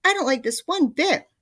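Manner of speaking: very high, loud frightened cry. A narrator's reading. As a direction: cry out in fear. Mr Twit panicking.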